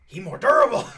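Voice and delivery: high loud squeaky voice; high loud kobold remark. Acted. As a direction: chirp it like a kobold.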